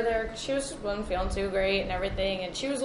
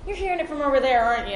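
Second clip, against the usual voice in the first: high, loud knowing question; retold old lady, loud and insistent; imitating older lady; 5 semitones higher, 8 dB louder, faster than usual. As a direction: call it out as the old lady, pitched up. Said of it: "she goes".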